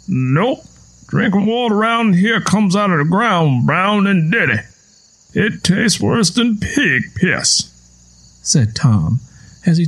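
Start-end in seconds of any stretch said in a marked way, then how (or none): 0.1-7.7 put-on old farmer Tom. A narrator's reading. Tom telling Lenny the children are gone, old farmer voice. Tom's voice.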